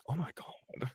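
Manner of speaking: barely-there, breath-only gasp of amazement; awed whisper. Retold or imitated. Amazed listener reacting to a grand voice, in an awed whisper. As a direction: mimic someone stunned, all in a whisper.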